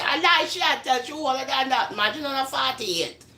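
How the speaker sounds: doubting critics. Acted. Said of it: "some people will say".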